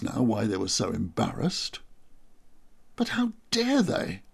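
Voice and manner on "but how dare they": indignant. Acted. Narrator indignant at insult.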